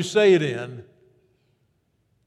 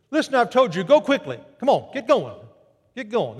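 higher, pressing command that drops off at the end; urgent angel; 4 semitones higher than usual. Acted. Angel hurrying the women back to Jerusalem, urgent. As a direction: hurry them along.

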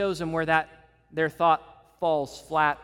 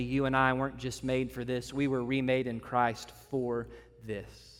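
usual speaking voice

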